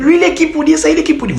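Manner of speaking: high-pitched mock-offended voice; indignant girls. Girls protesting at his preference, mock-indignant. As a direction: imitate outraged women, very high and quick. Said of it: "certaines filles qui vont se dire".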